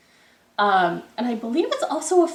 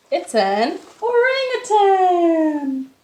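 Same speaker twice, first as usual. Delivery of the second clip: higher, louder and slow, savoring the name; excited reveal; 8 semitones higher, 9 dB louder, much slower than usual. Acted. Teacher revealing the answer to the children, amazed. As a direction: make the answer a big surprise.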